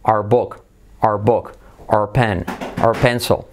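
"our" as "ar" in "our book", "our pen"; one-syllable "our" before each noun; native reduced "our". Shown as a demonstration. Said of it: "ellos siempre dicen are".

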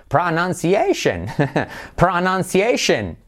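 high, slow "Pronunciation", PRA-NAN-SIEI-SHEN; playful over-accented "Pronunciation"; every syllable stressed. Shown as a demonstration. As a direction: punch each syllable of "Pronunciation". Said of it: "como que se acentúan todas".

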